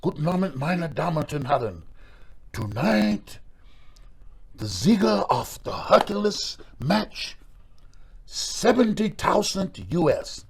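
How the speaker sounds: put-on high, slow announcer with German accent. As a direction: announce it like a German casino host. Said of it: "they come up and go".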